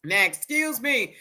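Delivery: ordinary talk, nothing marked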